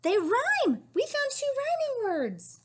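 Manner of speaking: high, loud delight; excited discovery. Real, not acted.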